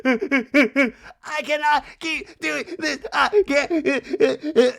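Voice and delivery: high, loud gasping fake sobs; forced sobbing. Kyle breaking down outside, mock sobbing. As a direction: choke out each word in hammed-up crying. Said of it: "like, just forced sobbing".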